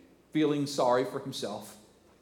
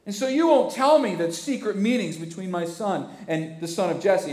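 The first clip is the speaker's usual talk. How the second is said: petulant king; 7 semitones higher, 7 dB louder than usual. Acted. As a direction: complain like a sulky king. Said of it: "he says".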